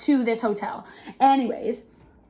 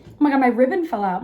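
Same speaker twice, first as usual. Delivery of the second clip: normal talking voice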